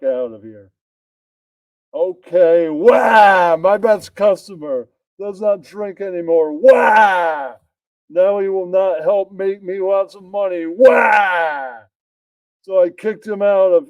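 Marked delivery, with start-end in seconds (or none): 1.9-11.8 loud, pitched-up mock wailing; wailing shout. Bartender loses his best customer, wails in a hammed-up shout. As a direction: wail it out like a hammed-up sob.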